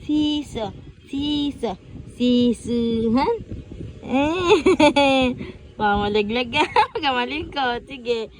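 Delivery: usual speaking voice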